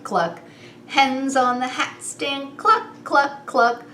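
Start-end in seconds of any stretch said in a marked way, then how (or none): none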